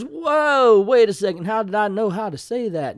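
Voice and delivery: high, loud mock astonishment; hammed-up puzzled hero; wondering aloud. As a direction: start on a big whoa, stay baffled. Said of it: "So he wonders".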